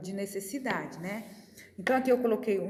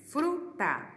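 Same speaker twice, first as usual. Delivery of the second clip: "fruta" split as fru, ta, pitched up; syllable by syllable; 6 semitones higher than usual. Shown as a demonstration. Teacher showing a two-syllable word, "fruta" broken into fru and ta. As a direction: sound out "fruta" in two separate beats. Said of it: "ela tem duas sílabas".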